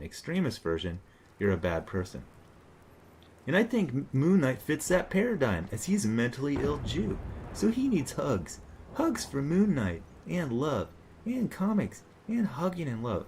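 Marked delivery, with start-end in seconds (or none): 3.5-13.2 high, exaggerated sing-song sarcasm; mock pleading. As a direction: plead sarcastically in a much higher voice.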